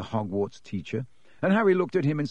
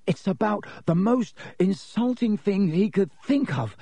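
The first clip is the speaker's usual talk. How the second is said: gasping; 8 semitones higher than usual. A narrator's reading. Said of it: "gasped Ron".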